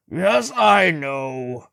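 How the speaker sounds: loud slow furious snap; angry. A narrator's reading. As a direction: explode with irritation. He stamps foot, furious.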